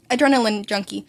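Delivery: ordinary delivery, nothing marked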